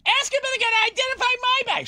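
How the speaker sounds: very high put-on voice of the wife; mock wife. Husband mimics his wife at the lost-bag desk, very high. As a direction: pitch way up like a fussy wife.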